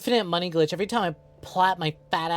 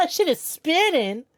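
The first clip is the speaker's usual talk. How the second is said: laughing; 11 semitones higher, 4 dB louder, slower than usual. Real, not acted. Laughing at spinning game character.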